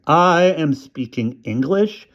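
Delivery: put-on boy answering his mother, disgusted. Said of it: "in a very disgusted voice".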